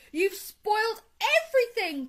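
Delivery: high, loud wail of blame; shrieked accusation; outraged cry. A narrator's reading. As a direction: explode with dismay at a friend. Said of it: "cried Toad".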